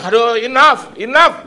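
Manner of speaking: high, loud exasperated cry of enough. Retold or imitated. Preacher reliving being fed up with debt, crying out high. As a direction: throw up your hands and cry out, fed up. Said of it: "ada rasa teriak bilang".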